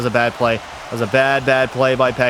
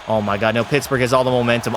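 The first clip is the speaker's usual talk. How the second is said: ordinary talk, nothing marked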